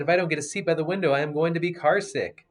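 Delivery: put-on high, loud, fast kid's whine; child pleading for the window seat, fast and loud; whiny child complaint. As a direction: whine it like a squashed kid demanding the window.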